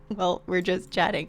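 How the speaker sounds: usual speaking voice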